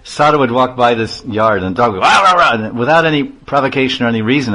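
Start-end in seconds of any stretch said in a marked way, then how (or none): 2.0-2.5 loud, high rah rah rah barks; imitated dog barking. Teller barks like the dog at the passing sadhu. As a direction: bark it out like an angry yard dog. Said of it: "the dog would just bark".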